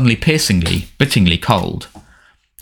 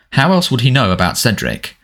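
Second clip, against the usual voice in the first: ordinary delivery, nothing marked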